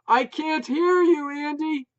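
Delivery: high, loud shout. A narrator's reading. Wife shouting news about the new dog, loud. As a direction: yell it over the dogs.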